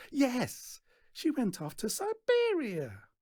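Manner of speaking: high, sharp voice climbing; bitter girl. A narrator's reading. Daughter recounting her mother leaving, high bitter. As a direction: tell it with a grudge.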